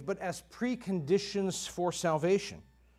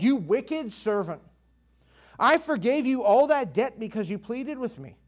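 the king lets his anger out on the servant; angry king; 5 semitones higher, 5 dB louder than usual. Acted. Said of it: "And in his anger".

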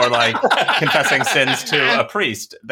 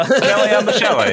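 playful; 9 semitones higher, 4 dB louder than usual. Acted. Echoing rhyme higher.

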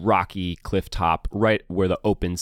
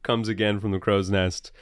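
usual speaking voice

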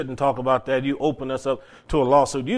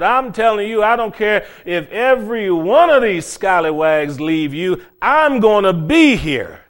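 loud, raised, insistent pledge; Peter, vehement vow; 5 semitones higher, 7 dB louder than usual. Acted. Disciple swearing to Jesus he will never leave, loud and vehement. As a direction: punch out each promise with bold conviction.

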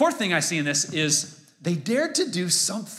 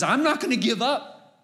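much higher, emphatic vow not to quit; determined; 10 semitones higher than usual. Acted. Preacher voicing the friends' never-give-up attitude, determined. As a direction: declare it with resolve, pitch raised. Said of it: "it's an attitude that says".

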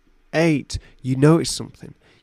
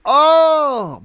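pain cry; 13 semitones higher, 8 dB louder than usual. Acted. Skin melting off in story.

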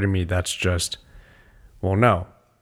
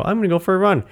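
mock chirpy; 9 semitones higher, much faster than usual. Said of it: "when I go".

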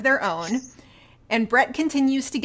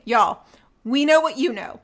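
usual speaking voice

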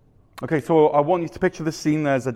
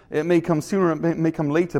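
ordinary talk

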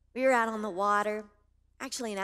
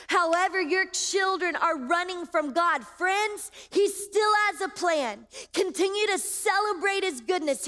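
high, raised preacher's voice; ringing, emphatic sermon delivery; full-force preaching; 7 semitones higher, 5 dB louder than usual. Acted. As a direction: declare it boldly over the congregation.

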